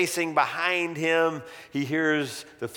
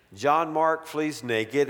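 usual speaking voice

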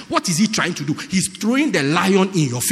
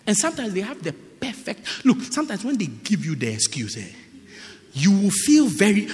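ordinary talk, nothing marked

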